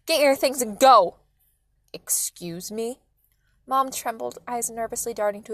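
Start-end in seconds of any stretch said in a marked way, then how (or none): 0.0-1.2 very loud angry command; forceful shouted order; yelling. A narrator's reading. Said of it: "Mom yelled". 1.9-3.0 low, slow, near-whispered challenge; half-whispered affronted question; menacing disbelief. A narrator's reading. Father challenges being thrown out.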